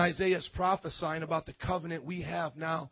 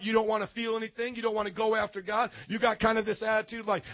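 usual speaking voice

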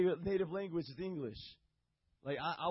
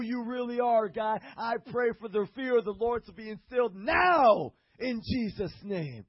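rising, louder heartfelt prayer; fervent prayer; 3 semitones higher, 6 dB louder than usual. Shown as a demonstration. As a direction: pray it out with growing fire. Prays aloud for Lewis.

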